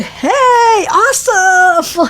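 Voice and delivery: high, slow, loud "hey awesome"; hammed-up relief at seeing people; mock cheerful greeting. Fleeing woman greets strangers.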